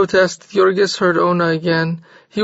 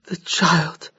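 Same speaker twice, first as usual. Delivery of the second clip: perplexed echo; 5 dB quieter than usual. A narrator's reading. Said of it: "he echoed in perplexity".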